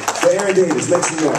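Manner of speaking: fast, loud crowd call-out; loud hype announcement. Real, not acted. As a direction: pump up the room. Fixing his slip, hyping the crowd.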